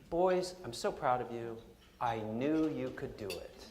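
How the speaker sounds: mother gently praising her sons after being away; sweet mother. Retold or imitated. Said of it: "switched back to the sweet mother".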